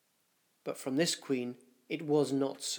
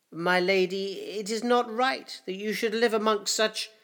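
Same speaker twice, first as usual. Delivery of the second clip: lightly voiced old courtier, higher, deferential; wizened old councillor; 7 semitones higher, 4 dB louder than usual. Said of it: "a wizened male bent double".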